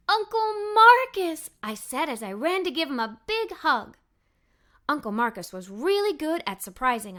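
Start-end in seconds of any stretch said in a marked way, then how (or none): 0.1-1.5 loud, slow, high joyful call; excited cry. A narrator's reading. Girl greeting her surprise uncle, excited cry. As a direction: call out his name with delight.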